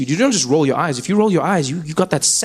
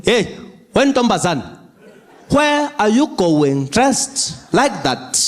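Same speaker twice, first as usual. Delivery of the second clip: stern dad; slower than usual. Retold or imitated. Father confronts daughter.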